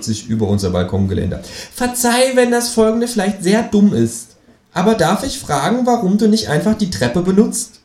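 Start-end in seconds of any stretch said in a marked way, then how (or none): 1.7-7.8 much higher, louder cartoon kangaroo; a high, cheeky kangaroo asking. Kangaroo asks the narrator abseiling from the balcony, high and cheeky. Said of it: "Känguru asks".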